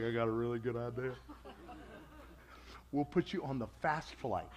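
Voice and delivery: dry, teasing answer from God; God's wry voice. Retold or imitated. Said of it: "And God's like".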